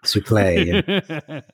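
suplex drawn out as "soo-playy"; echoed French-style pronunciation. Pretend. Co-host echoing the correction, suplex as "soo-play".

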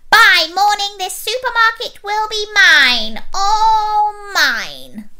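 high, loud villain boast; squeaky megalomaniac villain; gloating evil pea. A narrator's reading. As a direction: claim it all in greedy triumph. Evil pea gloats over plan.

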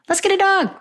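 high, bright mocking sing-song of what people say; mock cheerful advice. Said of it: "they say".

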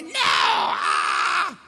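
high mock scream of refusal; toddler yelling. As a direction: imitate a child shrieking mid-tantrum. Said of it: "he's still yelling".

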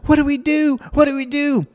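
high-pitched panicky child impression; imitated kid in a tizzy; Alex. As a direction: plead like a frantic little girl, high. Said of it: "So she goes".

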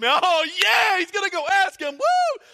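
loud, high, whooping cheer; excited cheer. Retold or imitated. Greek fans cheering that Philip will ask Jesus, loud whoop. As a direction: whoop like thrilled fans backstage.

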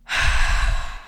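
long audible sigh out; deep sigh. Shown as a demonstration. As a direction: breathe out in a big sigh. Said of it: "a big sigh".

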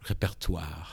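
repertoire said the French way; French pronunciation, with a throaty French r; French accent. Real, not acted. Speaker covering an unsure word, in a French accent. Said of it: "when I say it with a French accent".

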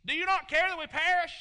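loud, high, frantic cry; panicked disciples. Acted. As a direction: cry out over the storm in panic. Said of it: "They wake up Jesus".

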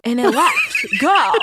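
laughing, loud and high. Real, not acted. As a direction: crack up while telling it. Laughing at the crash story.

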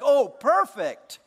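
slow, high, loud oh perfect; savoring delight. Retold or imitated. Pastor voicing delight at a milkshake, slow and high. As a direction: relish the first sip. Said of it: "It's like".

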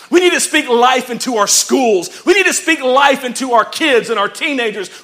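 high, very loud rallying preacher; full-force preaching. Real, not acted. Preacher urging the church to speak life, full-force shouting. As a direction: drive each line home with a shout.

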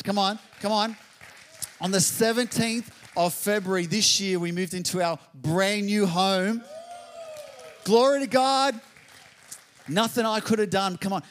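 loud, high preacher's cry; fiery preaching. Real, not acted. Pastor rousing the congregation, loud high preaching. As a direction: lift the room with a shout of praise.

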